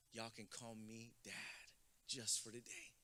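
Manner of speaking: tender. Retold or imitated. Chaperone dad offering himself to the boys, slow and soft. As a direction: say it softly like a kind promise. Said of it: "He goes".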